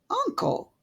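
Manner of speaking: high pleading call. A narrator's reading. Nephew pleading with his stubborn uncle. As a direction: plead gently with him. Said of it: "pleaded the nephew".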